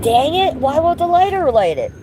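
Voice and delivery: high, exasperated whine at the lighter; annoyed pitch jump, voice rising up high; frustrated. Real, not acted. Frustrated streamer fussing at her lighter, high exasperated whine.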